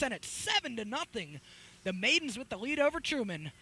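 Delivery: high-pitched, easing down from the peak; excited announcer, pitch held up high; elated goal follow-up. Real, not acted. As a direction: keep celebrating the goal on air. Celebrating the goal just scored.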